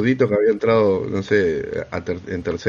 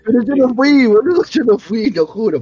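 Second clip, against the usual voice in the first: insistent denial; 10 semitones higher, 5 dB louder than usual. Real, not acted. Insisting innocence to friends.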